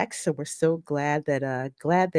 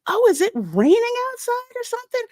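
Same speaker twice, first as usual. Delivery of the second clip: puzzled question. Retold or imitated. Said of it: "and I'm like".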